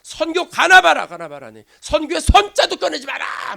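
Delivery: acted high, loud huffy refusal; angry outburst. Imagined Peter swearing off mission trips, loudly angry. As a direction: vow it off in mock fury. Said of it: "막 화를 내면서 말이죠".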